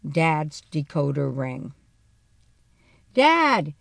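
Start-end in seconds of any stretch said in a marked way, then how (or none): 3.1-3.7 loud call through the house; shouting. A narrator's reading. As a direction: shout for him as you march in. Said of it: "I shouted".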